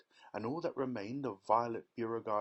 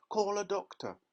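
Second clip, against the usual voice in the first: higher, louder urgent shout; 10 semitones higher, 5 dB louder than usual. A narrator's reading. Father demanding a doctor, urgent shout. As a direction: shout for help. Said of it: "shouted Mister Beauregard".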